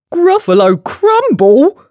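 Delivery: high, loud, rising disbelief; hammed-up monster panic; alarmed question. A narrator's reading. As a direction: squawk it back as a frightened question. Gruffalo repeats it in alarm.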